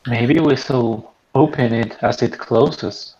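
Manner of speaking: usual speaking voice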